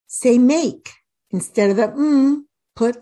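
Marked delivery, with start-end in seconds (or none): 1.9-2.5 "m" as a held hum, mmm; isolated letter sound. Shown as a demonstration. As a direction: hum "m" rather than naming the letter. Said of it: "You wanna say the sound".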